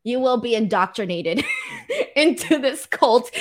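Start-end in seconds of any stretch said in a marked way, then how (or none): 1.8-3.3 laughing through the words; cracking up while teasing the co-host about the cult. Real, not acted. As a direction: giggle out the end of the line.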